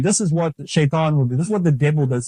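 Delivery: normal talking voice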